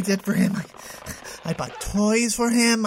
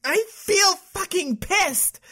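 furious; 6 semitones higher, slower than usual. Acted. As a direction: drag each word out in rage. Telling therapist her rage.

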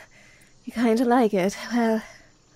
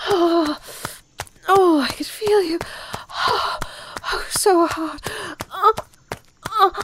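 breathless moans building up, voice cracking; moaning; 6 semitones higher, 4 dB louder, slower than usual. Acted. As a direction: let the moans climb, gasping between words.

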